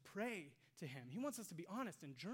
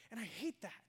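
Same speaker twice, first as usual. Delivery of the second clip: normal talking voice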